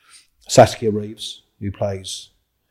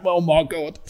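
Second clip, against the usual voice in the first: scared; 9 semitones higher, 5 dB louder, slower than usual. Retold or imitated. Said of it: "shitting himself".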